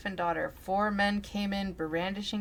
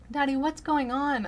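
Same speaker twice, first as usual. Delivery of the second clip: put-on high, louder little girl voice; child's question; 8 semitones higher, 6 dB louder than usual. Daughter asks her father what is happening, high child voice. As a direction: sound like a frightened small child.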